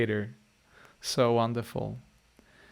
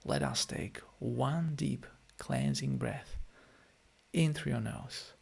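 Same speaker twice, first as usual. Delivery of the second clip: guided meditation; 9 dB quieter than usual. Acted. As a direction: gently ease the listeners back into their bodies. Leads a closing guided meditation.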